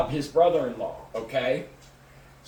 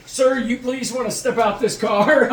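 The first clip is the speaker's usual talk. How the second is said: raised, louder mock-official voice; imitated cop order; 6 semitones higher, 6 dB louder than usual. Cop to smoke-filled car's driver, imitated loud order.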